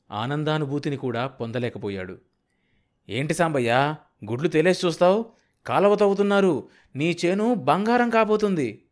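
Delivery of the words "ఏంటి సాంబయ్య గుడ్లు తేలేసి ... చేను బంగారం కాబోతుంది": high, loud, gleeful urging; excited. A narrator's reading. Kanakayya telling Sambayya his field will turn to gold, excited. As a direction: jolt a stunned man with great news. Breaking good news to stunned friend.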